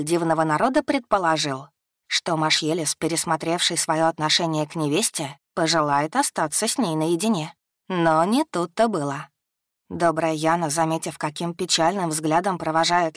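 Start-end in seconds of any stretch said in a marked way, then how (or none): none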